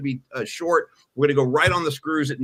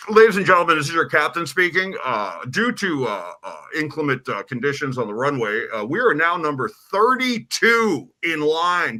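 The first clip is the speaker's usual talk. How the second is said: over a pilot headset; 2 semitones lower than usual. Retold or imitated. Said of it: "Let me reenact this for you".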